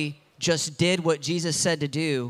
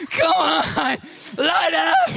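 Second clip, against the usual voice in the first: high, loud call, then a laugh; mock shout; 11 semitones higher, 3 dB louder, much slower than usual. Preacher urging the sidewalk to light up, a high mock shout. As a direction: cheer the sidewalk on.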